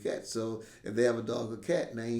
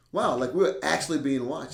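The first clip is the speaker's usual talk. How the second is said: alarmed exclaim; 4 semitones higher, 8 dB louder than usual. Retold or imitated. Wife telling husband, alarmed.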